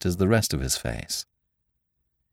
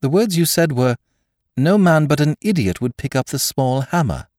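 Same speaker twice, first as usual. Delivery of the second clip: high, tense quoting; mastering discomposure; 9 semitones higher, 4 dB louder than usual. A narrator's reading. Curate repeating the doctor's words, mastering his discomposure. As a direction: agitation held barely in check. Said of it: "mastering his discomposure".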